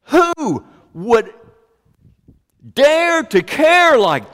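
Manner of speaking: high, emphatic rhetorical questions; impassioned preaching. Real, not acted. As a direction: preach it with force, stretching key words. Preaching at full force.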